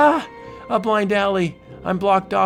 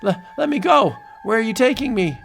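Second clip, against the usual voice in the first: high panicked plea; terrified pleading; 10 semitones higher, 4 dB louder, faster than usual. Acted. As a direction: beg in high-pitched terror. Captured man pleads.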